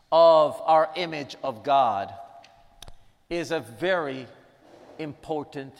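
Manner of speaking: ordinary delivery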